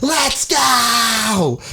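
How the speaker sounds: put-on drunk shout. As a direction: yell it like a drunk hyped friend. Drunk friend shouting at party.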